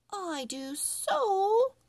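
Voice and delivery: put-on stubborn kid digging in her heels; insistent little girl. A narrator's reading. Girl Emily insists in story.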